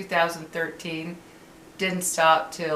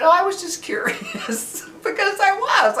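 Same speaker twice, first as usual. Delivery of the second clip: high and laughing, much higher pitch; giggling sheepishly at her own curiosity; real laughter, high-pitched; 14 semitones higher, 3 dB louder than usual. Real, not acted.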